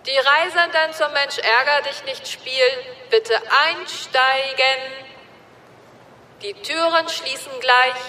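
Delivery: station announcement. A narrator's reading. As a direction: read it like a platform announcer. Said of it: "eine Lautsprecherdurchsage".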